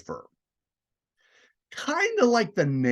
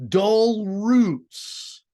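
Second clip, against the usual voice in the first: poem recital; 6 semitones higher, 4 dB louder than usual. Shown as a demonstration. Teacher reciting the poem's opening.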